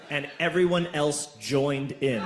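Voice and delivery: ordinary talk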